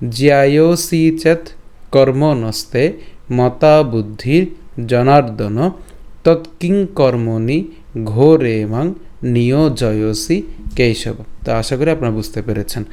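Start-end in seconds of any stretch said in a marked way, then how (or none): 0.1-11.1 slow, measured Sanskrit recitation, phrase by phrase; careful teaching recitation with pauses between phrases; Sanskrit verse pronunciation. Shown as a demonstration. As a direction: draw out the long vowels as a model for learners. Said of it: "আমি যদি আবার প্রথম থেকে বলি".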